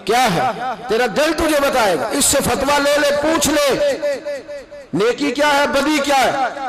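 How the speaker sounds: rising sermon fervour. Acted. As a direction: preach it out, pushing harder with every line. Preaching builds to full force. (بلند ہوتی ہوئی واعظانہ پکار)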